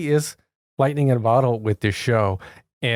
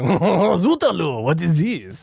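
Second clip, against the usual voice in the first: drawn-out cartoon French accent; French chef; 4 semitones higher, much slower than usual. Imitating the Little Mermaid chef, hammed-up French accent. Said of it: "the chef, like".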